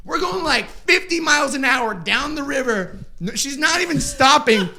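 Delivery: breathless retelling of a wild ride; excited. Retold or imitated. Retelling frantic fast paddling.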